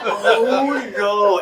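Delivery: high, laughing dismay. Retold or imitated. As the teacher shakes him, laughing oh no. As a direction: laugh in embarrassed panic. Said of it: "I was like".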